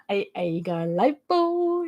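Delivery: imitated brother's thick Norfolk; broad Norfolk accent. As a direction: ham up a broad Norfolk accent, stretching the last word. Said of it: "he is quite broad".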